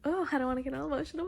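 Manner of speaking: ordinary talk, nothing marked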